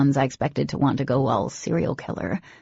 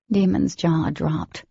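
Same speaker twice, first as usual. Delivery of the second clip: usual speaking voice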